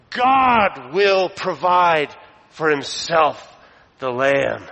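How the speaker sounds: God high and drawn out, then slowly descending; stress on GOD. Shown as a demonstration. Preacher makes the subject crystal clear, emphatic on God. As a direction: proclaim God first and strongest, very slowly. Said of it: "it's crystal clear".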